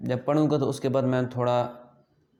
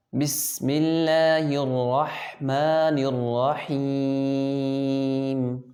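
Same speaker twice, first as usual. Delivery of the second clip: very slow, measured tajweed recitation; Quran recitation; much slower than usual. Shown as a demonstration. Teacher models Bismillah for pupils to copy, slow recitation. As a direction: hold the long vowels, drawing out the ending. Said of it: "آپ میرے پیچھے repeat کیجئے".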